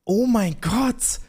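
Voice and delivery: stunned. Real, not acted. Friend hears the punchline, slow and amazed. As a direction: let it hang in real disbelief.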